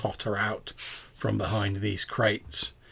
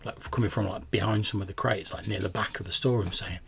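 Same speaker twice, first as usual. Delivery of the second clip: ordinary delivery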